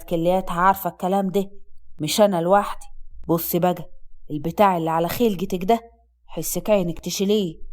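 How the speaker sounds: low, stern male warning; sharp. A narrator's reading. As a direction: lay down the law in a deep, cutting voice. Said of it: "قال بحدة".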